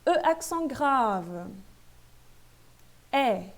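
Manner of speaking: "É" held as open French è; name "E, accent grave" slowly, then sound open "É". Shown as a demonstration. Teacher presenting the grave accent, open E. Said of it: "a mesma fonética do E accent grave".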